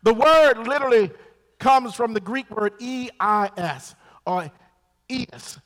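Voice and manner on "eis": eis said as one syllable, like ice; eis pronunciation. Shown as a demonstration. Preacher giving his own reading of the Greek word, eis said as ice. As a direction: pronounce eis plainly as ice. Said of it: "That's how I pronounce it".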